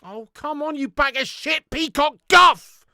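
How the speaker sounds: furious insult, building up; angry shout. Real, not acted. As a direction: build to a loud slow insult. Insulting player after miss.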